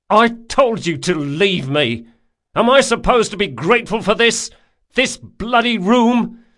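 loud, high-pitched outburst; furious shouting. A narrator's reading. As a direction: yell in outrage at your friend. Shakes fists, furious at his friend.

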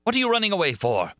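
high, furious shout after her. A narrator's reading. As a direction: chase her with rage. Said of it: "asked the furious voice".

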